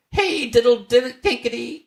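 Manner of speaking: very high, loud mouse squeal; squeaky mice. A narrator's reading. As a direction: squeak it back at the cat. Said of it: "answered the little mice".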